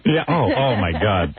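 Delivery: ordinary talk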